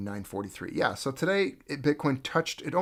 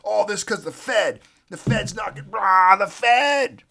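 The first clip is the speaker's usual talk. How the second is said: high, hammed-up whiny panic, getting louder; mock panic; 8 semitones higher, 8 dB louder, slower than usual. Mocking gold holders, high whiny fake panic. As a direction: whine about the Fed like a panicked trader. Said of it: "They're like".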